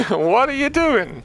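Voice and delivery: high, slow laughing disbelief. Real, not acted. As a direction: laugh through the question. Repeat with laugh.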